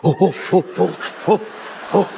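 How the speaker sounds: low ape grunts "oh, oh"; gorilla grunts. Retold or imitated. [name] grunting at the silverback, low ape grunts. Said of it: "I said".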